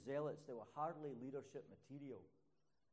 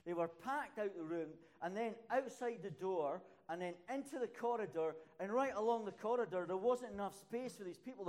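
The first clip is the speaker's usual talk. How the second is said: higher, louder, rising excitement; excited retelling; 5 semitones higher, 6 dB louder than usual. Real, not acted. Preacher to congregation, describing the packed room, excited and loud. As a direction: let the excitement build as you tell it.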